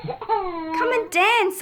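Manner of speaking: high, slow, drawn-out call. Acted. Girl calling her little sister to dance in rain. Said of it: "I cry".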